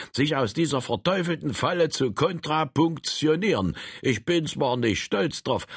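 put-on a puffed-up old fellow showing off; pompous old man. Frank's pompous boasting speech.

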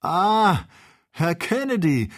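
high, loud, gleeful call; bursting with hilarity. A narrator's reading. Joe calling Kennedy, fit to burst with laughter. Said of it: "wollte bersten vor Lachen".

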